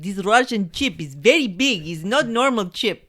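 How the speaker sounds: loud, high, slow hammed-up Russian accent; very bad Russian accent. Host mocking the scientist's accent, very bad Russian accent. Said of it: "in his very bad accent".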